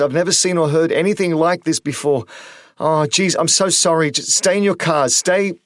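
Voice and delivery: high, loud and rushed, near hysteria; a terrified radio operator losing it; hysterical panic. A narrator's reading. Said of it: "nearly hysterical pitch".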